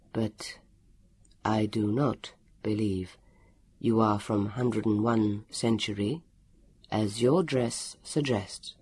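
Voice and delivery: low, slow, deliberate; calm interrogation. A narrator's reading. Elio challenging his captive's story, calm and slow. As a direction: speak evenly, spacing the phrases out.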